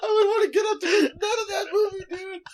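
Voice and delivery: laughing. Real, not acted. Laughing at own joke.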